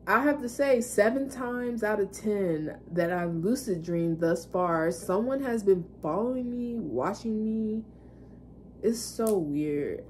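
ordinary delivery

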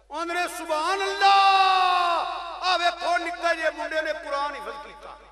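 loud, high cry with long-held vowels; full-voiced sermon shout; exultant preaching. Real, not acted. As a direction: proclaim the praise to the whole gathering. Preacher proclaims boy's achievement.

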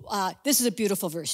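ordinary talk

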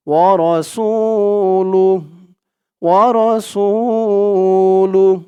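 slow "ওয়া রসুলুহ" with a long held "সু"; one-alif elongation. Shown as a demonstration. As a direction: stretch the "সু", then close on a short breathed h. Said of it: "এক আলিফ টান দিয়ে পড়তে হয়".

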